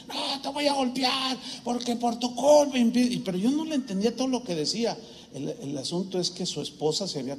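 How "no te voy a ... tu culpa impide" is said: hammed-up threat to hit him; mock furious threat. Angry man threatens pastor. (amenaza furiosa imitada, aguda y lenta)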